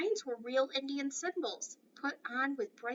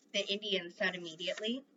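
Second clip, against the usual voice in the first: usual speaking voice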